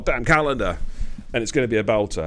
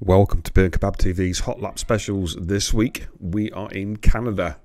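loud segment intro; announcer read; 4 dB louder than usual. Acted. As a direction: announce the show title. Intro to recorded hot lap.

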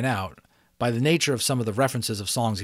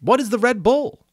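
much higher, louder startled question; alarmed question; 14 semitones higher, 9 dB louder than usual. A narrator's reading. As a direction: ask in alarm. Unicorn asking.

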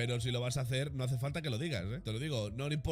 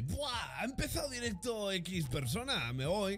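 higher, slow whiny viewer voice; mock viewer quitting; 7 semitones higher, slower than usual. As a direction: mimic a bored viewer leaving. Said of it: "Luego está la gente que dice".

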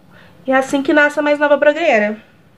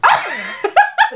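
burst of laughter. Real, not acted. Laughs after eyelash mishap.

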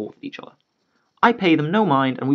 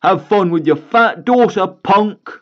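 mocking yell; 6 semitones higher, 4 dB louder, slower than usual. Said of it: "Dad yells".

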